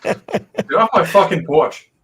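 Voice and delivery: slow, emphatic Boston accent; natural Boston accent. Shown as a demonstration. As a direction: show how a Boston native says it. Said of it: "that's literally how we".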